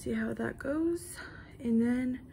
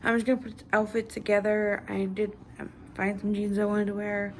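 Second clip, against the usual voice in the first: ordinary talk, nothing marked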